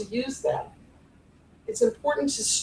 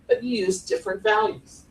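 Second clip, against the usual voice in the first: ordinary talk, nothing marked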